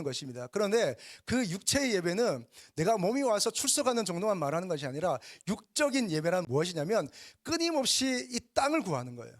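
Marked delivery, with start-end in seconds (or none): none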